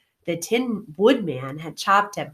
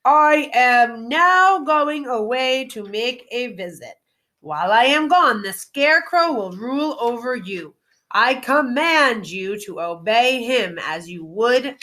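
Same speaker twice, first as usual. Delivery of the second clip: loud proclamation; 3 semitones higher than usual. Acted. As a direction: address the whole crowd from the basket. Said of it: "said to all the people in a loud voice".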